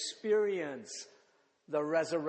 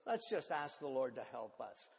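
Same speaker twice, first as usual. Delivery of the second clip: usual speaking voice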